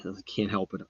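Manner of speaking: normal talking voice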